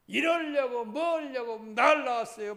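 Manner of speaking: put-on high, loud, slow sulky child's complaint; a mock petulant child raising his voice; whining resentful child. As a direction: act out a child blaming his parents.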